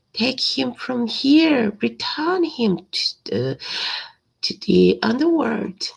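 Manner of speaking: scared king. Acted. Scared king orders Cerberus away.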